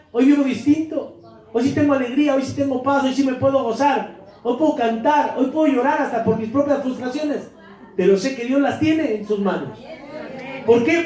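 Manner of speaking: ordinary delivery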